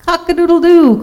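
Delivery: very high, ringing cock-crow; crowing rooster. A narrator's reading. Rooster sounds off at Turkey, crowing. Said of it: "crowed Rooster".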